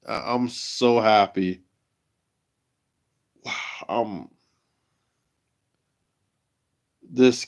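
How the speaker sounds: real joy, subdued and nearly lost for words; emotional, overjoyed. Real, not acted. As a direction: overcome with pride, pausing to sigh and gather yourself. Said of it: "I'm so emotional right now".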